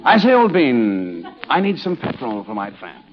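mock upper-crust English accent; Ronald Colman. Host showing a friend how English actors talk, mock English accent. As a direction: put on a drawn-out English gentleman's accent. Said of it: "You know, like Ronald Colman".

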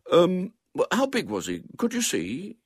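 put-on high, hesitant boy's question; discreet, cautious. A narrator's reading. Boy sizing up the man before fighting, cautious. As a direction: back off carefully with a question. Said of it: "said William the discreet".

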